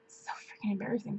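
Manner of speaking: whispered aside, embarrassed; a real embarrassed whisper under the breath. Real, not acted. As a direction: drop to a whisper as if confessing. Embarrassed aside mid-story.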